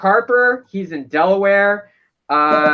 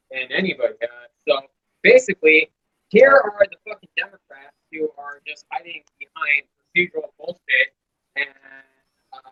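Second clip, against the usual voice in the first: ordinary talk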